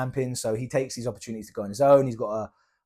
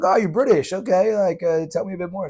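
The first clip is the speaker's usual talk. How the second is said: higher, louder, lightly put-on friendly American; eager old American; 7 semitones higher, 7 dB louder than usual. Old camper delighted to meet a Brit, lightly voiced. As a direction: gush a little, charmed by the accent.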